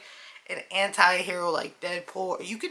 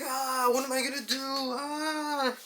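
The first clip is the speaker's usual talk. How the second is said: hammed-up trapped panic, pitched higher; mock panic; 6 semitones higher, slower than usual. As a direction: play the trapped guy's whiny panic. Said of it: "He's like".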